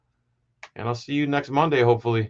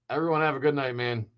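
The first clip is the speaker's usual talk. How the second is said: usual speaking voice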